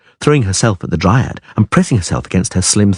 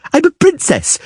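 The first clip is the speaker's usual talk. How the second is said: put-on high child's voice; much higher girl's insistence; insistent girl. A narrator's reading. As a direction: stamp it out proudly.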